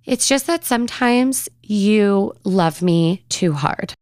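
slow, halting imitation of a child confessing; struggling to say it. Seven-year-old finally confessing to mommy, slow and halting. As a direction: get it out slowly, with effort. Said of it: "finally spit the following sentence out".

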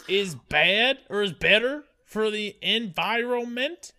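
slow, hammed-up Southern drawl; Southern accent. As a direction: rant slowly like a Southerner. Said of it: "goes straight Southern".

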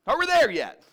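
put-on high, loud child's whine; child nagging on a long drive; whiny kid. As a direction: pester dad from the back seat. Kids in the back seat again.

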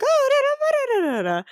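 mock chatter in nonsense syllables, starting high; chattering group of ladies. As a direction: imitate women getting worked up, in da-da-da. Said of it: "getting a little, like, louder in volume".

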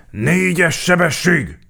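high, loud sergeant's cry; shouted command. A narrator's reading. Sergeant calling out the gear to the crew, shouting. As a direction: yell it out as an order. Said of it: "rikkantott az alőrmester".

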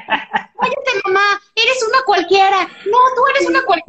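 high-pitched mock quarrel with a laugh in it; pelea imitada. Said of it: "se empezaron a pelear así de". (pelea fingida con voz aguda)

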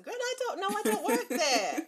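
high, loud exasperated retort. Real, not acted. A stylist answering a nosy question, exasperated and high.

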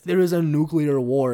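ordinary talk

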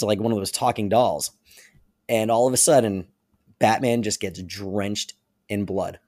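normal talking voice

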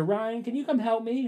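mock sweet call for help; wife calls him from the curtains, high falsetto.

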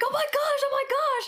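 high, fast, breathless mock panic; excited. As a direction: rush it, staged excitement. Faking alarm to wake husband.

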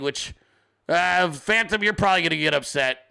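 usual speaking voice

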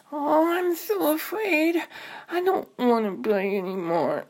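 put-on frightened little girl. Little sister stuck up the tree, high and afraid. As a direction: beg like a scared child.

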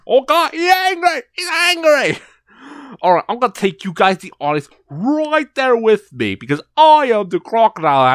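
hammed-up Australian wildlife host; excited, loud Australian crocodile-show presenter; Australian accent. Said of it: "me doing an Australian accent".

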